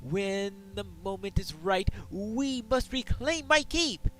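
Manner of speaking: proud king. Acted. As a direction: declare it like a stubborn king in his hall. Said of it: "I am the King of Frostbluff".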